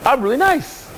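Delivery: high pleading whine; whiny nice guy. Retold or imitated. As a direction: whimper it like a rejected nice guy.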